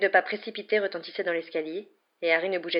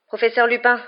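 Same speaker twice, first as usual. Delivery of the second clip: loud, raised interruption; forceful voice; 5 semitones higher, 8 dB louder than usual. A narrator's reading. Boy interrupting his professor, in a strong voice. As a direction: cut in firmly and higher. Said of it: "intervint Harry d'une voix forte".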